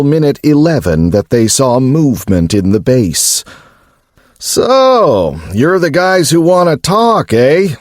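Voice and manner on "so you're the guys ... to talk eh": hint of mockery. A narrator's reading. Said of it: "a voice said with a hint of mockery".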